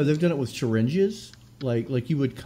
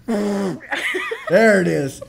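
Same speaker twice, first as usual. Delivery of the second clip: loud, higher, drawn-out raspberry buzz; blowing a raspberry; 6 semitones higher, 4 dB louder, much slower than usual. Real, not acted. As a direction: blow a wet raspberry. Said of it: "Let's do it".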